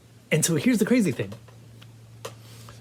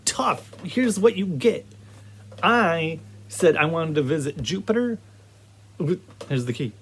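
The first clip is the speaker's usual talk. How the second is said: ordinary talk, nothing marked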